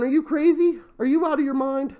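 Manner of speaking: high-pitched mock disbelief; retold incredulous outburst. Vlogger retelling a reaction to a five-hundred-dollar quote, mock incredulous. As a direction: act out balking, voice pitched high.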